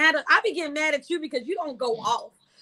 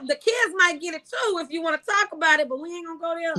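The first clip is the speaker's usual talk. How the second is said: ordinary talk, nothing marked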